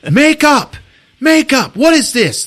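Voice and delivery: high, loud mock outrage; imitated loud demand. Director spots a mole on his actress, shouting in outrage. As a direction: call out sharply for makeup, hammed-up.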